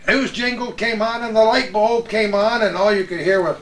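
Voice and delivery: ordinary talk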